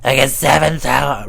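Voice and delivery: hammy little brag; mock boast. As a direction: crow it as a silly brag. Repeats the joke boast.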